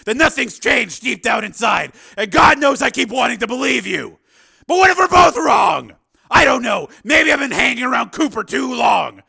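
loud, high, impassioned character voice; anguished Steele, self-doubting. A narrator's reading. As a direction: pour out the doubts at near-shout, pitched high.

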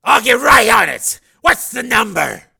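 loud, high barking chief; gruff police chief. Acted. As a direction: bark like a surly boss.

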